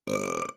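low, rumbling burp; deep belch. Real, not acted. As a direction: let out a short burp. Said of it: "I always burp on the podcast".

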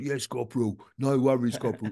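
mock English squaddie accent; imitated military English accent, eager to comply; put-on English accent. Scottish recruit answering his corporal in a put-on English accent. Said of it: "he made me speak like that".

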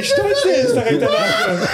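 high, squeaky words through laughter; laughing. Real, not acted. Teasing the proud editor while laughing hard.

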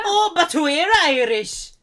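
eager Irish claimant. Retold or imitated. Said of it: "He goes".

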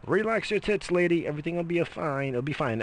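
high, sarcastic calming voice; mocking high voice. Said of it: "It's like".

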